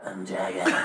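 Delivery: low, lightly voiced dragon; a hint of a growly dragon; dragon impression. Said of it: "Alright, you ready?".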